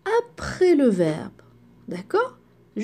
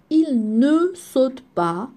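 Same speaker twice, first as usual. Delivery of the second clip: slow example; slower than usual. Shown as a demonstration. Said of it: "teacher models negation slowly".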